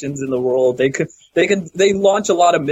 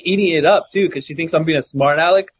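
normal talking voice

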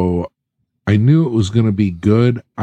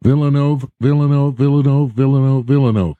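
higher, evenly repeated name on request; rapid-fire repetition; 6 semitones higher, faster than usual. Shown as a demonstration. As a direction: rattle off the name like a drill. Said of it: "can you say it five times real fast".

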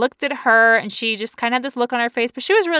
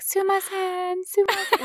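high, lightly voiced apologetic clerks; imitating Japanese clerks; 9 semitones higher than usual. Post office staff apologizing to her, high polite mock voice. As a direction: mimic polite bowing apologies. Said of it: "saying sorry, like".

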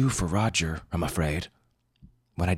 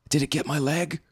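high, frantic question in shock; shocked surfer begging to know; panicked; 11 semitones higher than usual. A narrator's reading. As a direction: cry it out in terror, much higher. Bitten surfer panics on the sand.

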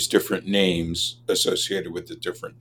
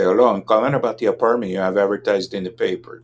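quick Los Angeles minority dialect accent; faster than usual. Shown as a demonstration. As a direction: rattle it off fast in the Los Angeles accent he grew up with. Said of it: "And I grew up in Los Angeles, and so I could call back and say".

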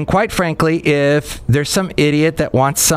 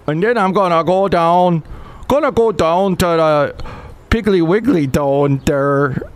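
a lightly put-on Canadian accent, pitched higher; wandering Canadian accent; 6 semitones higher than usual. Mocking the host in a vague Canadian accent, higher. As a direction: drift into a half-hearted Canadian accent. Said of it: "Don't know where that accent was going".

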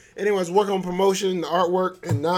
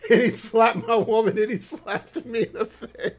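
laughing; 4 semitones higher than usual. Real, not acted. Laughing through retold slap.

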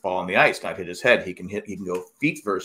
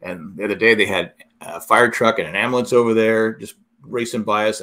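ordinary talk, nothing marked

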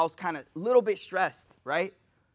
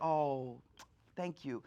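mock meek thanks; 8 semitones lower, 12 dB quieter, much slower than usual. Mock meek reaction rejected.

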